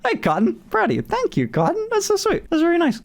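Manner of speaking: way up high in pitch, cooing thanks; high delighted cooing; gushing thanks. Real, not acted. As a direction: gush over them like a sweet gift. Thanking a viewer named Cotton.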